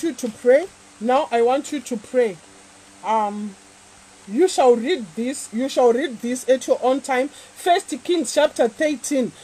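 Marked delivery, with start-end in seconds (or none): none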